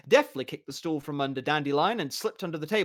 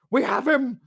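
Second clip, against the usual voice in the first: much higher, loud shout; triumphant yell; 11 semitones higher, 8 dB louder than usual. Acted. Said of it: "the mason shouted".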